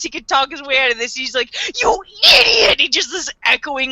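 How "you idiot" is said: high, loud hammed-up yell; mock angry shout. Fan imitates Light screaming at Mikami, mock angry shout. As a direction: scream it at the fool who ruined everything. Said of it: "angry voice".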